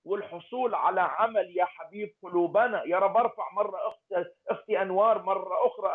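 ordinary talk

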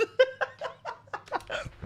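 breathless high wheeze of laughter; wheezing laugh. Real, not acted. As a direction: laugh until the voice goes silent.

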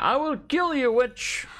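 high, hammed-up heroic threat; dramatic threat. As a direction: declare it like a melodramatic hero. Player hams up a threat.

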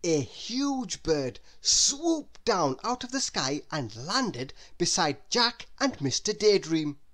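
plain reading voice

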